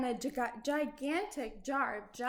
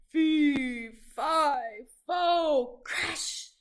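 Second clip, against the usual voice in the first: falling giant roar; 3 semitones higher, 7 dB louder, much slower than usual. Acted.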